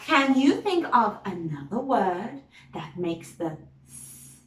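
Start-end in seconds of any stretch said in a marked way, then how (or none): 3.9-4.4 S as a long unvoiced hiss; tongue behind teeth, just hiss S; hissed S sound. Shown as a demonstration.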